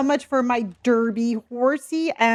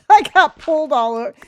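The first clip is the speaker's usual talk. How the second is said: laughing; 5 semitones higher, 7 dB louder, faster than usual. Real, not acted. Laughing about dog walk.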